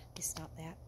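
a real whisper to herself. Real, not acted. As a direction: whisper it under your breath, composing yourself. Composing herself after emotion.

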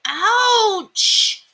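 a hurt wishing well; well says ouch. A narrator's reading. Well hit by a penny, pained yelp. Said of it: "said the wishing well".

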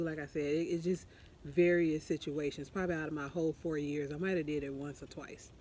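usual speaking voice

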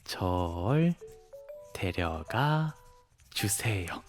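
low, soft, drawn-out words; writing aloud. Acted. Dog boy writing his adoption sign, slow and absorbed. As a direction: say it slowly as you write.

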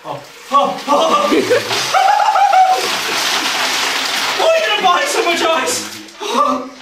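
gasping, shrieking from the cold; high yelps from the ice shock; in ice cold water. Real, not acted. Ice water poured on him.

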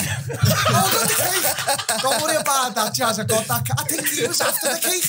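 high, laughing triumphant boast. Real, not acted. Telling John he still got the cake, laughing. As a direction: gloat about the cake through laughter.

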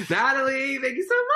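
high, loud squeal of gratitude; real thrilled shriek; excited thanks. Real, not acted. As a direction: squeal your thanks, shooting up at the end. Thanking viewer for a gift.

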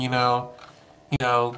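usual speaking voice

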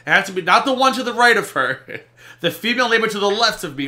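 loud, high-pitched mocking of a fussy storyteller; mocking exasperation. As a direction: push it high and loud, ridiculing the detail. Mocking the storyteller's excessive detail.

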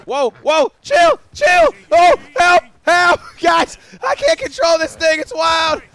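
high, loud frantic yelling between laughs; panicked laughing shouts. Real, not acted. Streamer losing control of a wild horse, panicked laughing shouts. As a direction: holler like you're barely hanging on to a bucking bronco.

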